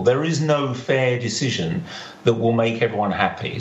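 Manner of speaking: ordinary delivery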